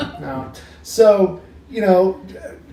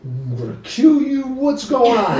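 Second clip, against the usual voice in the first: lightly voiced groggy grumble, higher; imitated sleeper woken up; 5 semitones higher than usual. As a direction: imitate someone roused in the night. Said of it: "'cause it's the middle of the night".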